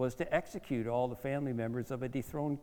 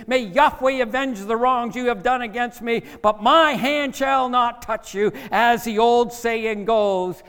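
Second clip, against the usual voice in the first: high, loud, impassioned declaration; David; 10 semitones higher, 9 dB louder than usual. Acted. David defending himself to King Saul, loud and high. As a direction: proclaim your innocence at full voice. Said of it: "he said to King Saul".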